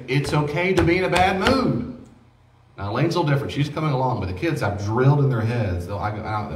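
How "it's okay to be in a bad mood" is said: reassuring. Retold or imitated. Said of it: "I always say".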